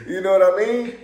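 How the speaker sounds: loud, high, through real laughter; words riding on a big laugh. Real, not acted. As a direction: crack up while checking they follow. Cracking up at own remark.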